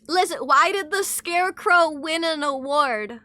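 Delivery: animated, projected question; emphatic joke setup. Pretend. Streamer telling chat a dad joke, emphatic setup. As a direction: pitch the riddle out to the room.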